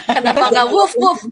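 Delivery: laughing. Real, not acted. Joking while laughing.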